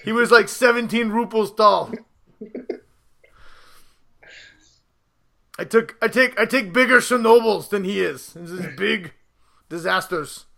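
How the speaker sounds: put-on lightly done Russian strongman accent; a hint of a Russian boxer. Mother Russia, Apollo parody.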